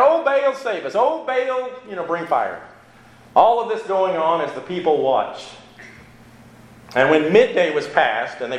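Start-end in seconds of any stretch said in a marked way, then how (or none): 0.0-2.6 crying out. Retold or imitated. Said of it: "Crying out".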